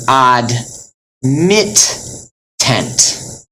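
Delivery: ad, mit, tent with a pause between each; separated syllables. Shown as a demonstration. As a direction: break it as ad / mit / tent, sounding both t's. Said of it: "separate them up into separate syllables".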